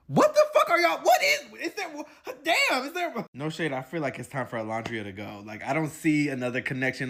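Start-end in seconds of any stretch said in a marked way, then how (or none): 0.1-3.1 much higher, louder incredulous shriek; exasperated high yell. Real, not acted. Reacting to the couple's empty talk, shrieking in disbelief.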